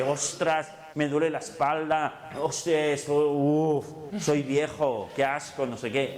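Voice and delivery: hammed-up self-pitying whine; mock complaining. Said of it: "Si estás todo el día diciéndote".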